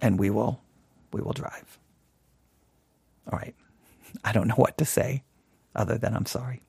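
low, hushed, deflated murmur; sheepish apology. Pretend. Driver apologising to grumbling passengers, subdued and sheepish. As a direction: trail away softly, at a loss for words.

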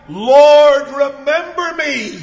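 loud, high, drawn-out plea; retold cry. Preacher voices the dying thief turning to Jesus, loud cry. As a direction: cry out to the Lord at full force. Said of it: "he cried".

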